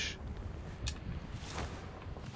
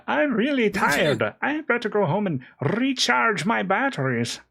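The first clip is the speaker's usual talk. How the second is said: high mock Archibald imitation; 12 semitones higher, 3 dB louder than usual. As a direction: squeak it like a tired cartoon character. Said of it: "Archibald going".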